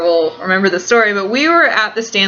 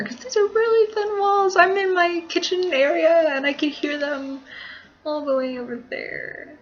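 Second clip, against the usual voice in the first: soft, high, wary voice; hushed complaint; 9 semitones higher, 7 dB quieter than usual. Real, not acted. Vlogger with people outside thin walls, hushed complaint. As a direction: keep it down so the neighbours can't hear.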